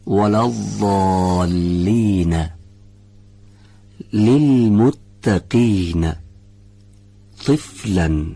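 slow, drawn-out tajwid recitation; Quran recitation. Shown as a demonstration. Teacher repeating the recitation, slowly chanted.

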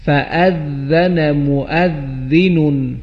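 فأذن مؤذن drawn out slowly, ذ between the teeth; slow Quranic recitation. Shown as a demonstration. Teacher demonstrating the letter ذال, slow Quranic recitation.

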